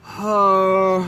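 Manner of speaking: long drawn-out huff; exasperated sigh. Retold or imitated. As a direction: let out a big, long sigh at the request. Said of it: "I just was like".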